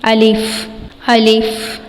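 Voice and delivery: الف said cleanly, neither stretched nor jerked; tajweed letter demo. Shown as a demonstration. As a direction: give الف an even sound, no pull, no jolt. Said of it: "بلکہ معروف طریقے سے ادا کرنا ہے". (الف نہ کھینچ کر نہ جھٹکے سے)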